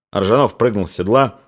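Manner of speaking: ordinary delivery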